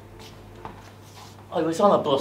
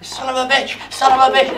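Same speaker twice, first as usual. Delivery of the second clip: higher, building to a loud exclamation; recalling a joyful memory, rising to a shout; ecstatic outburst; 10 semitones higher, 6 dB louder, faster than usual. Retold or imitated. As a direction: burst out in rapturous glee. Ecstatic memory of Thin Lizzy.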